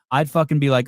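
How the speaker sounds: normal talking voice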